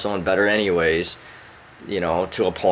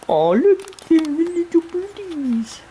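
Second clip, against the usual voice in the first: cooing at a bird; slower than usual. Real, not acted. Cooing at a bird outside the window in high baby talk. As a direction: coo up high at the little bird.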